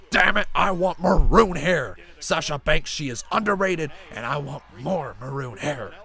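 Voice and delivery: loud, hammed-up angry boss; Vince McMahon. Streamer mock-ranting as the boss demanding maroon hair, bad impression. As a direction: bellow it as a badly faked wrestling boss. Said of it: "worst Vince McMahon accent".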